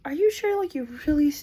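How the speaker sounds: doubting she can really walk; incredulous nurse. Retold or imitated. Nurse doubting the mom can walk, incredulous.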